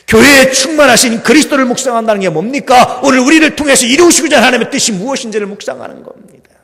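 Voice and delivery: preaching at full force. Acted. Pastor exhorting his church, full-force preaching.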